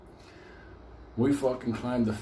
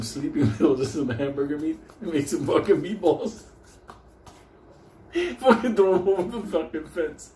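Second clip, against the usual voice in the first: cracking up; 3 semitones higher than usual. Real, not acted. Storyteller cracking up over the meatball plan, laughing. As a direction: giggle your way through the words.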